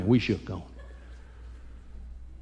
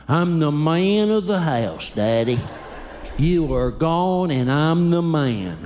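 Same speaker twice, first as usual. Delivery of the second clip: proud little man; 2 semitones higher, slower than usual. Retold or imitated. Young son on the phone claiming he's in charge, slow and proud. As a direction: puff up like the man of the house.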